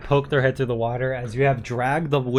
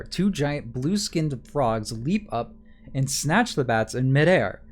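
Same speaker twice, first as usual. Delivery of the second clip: scene narration. Acted. GM reads scene description.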